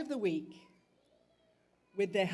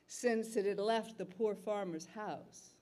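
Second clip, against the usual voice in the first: plain reading voice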